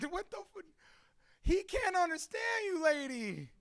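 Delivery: very high, squealed retort to a heckler; exasperated disbelief. Retold or imitated. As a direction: retell it in high-pitched outrage. Retold outraged reply to heckler.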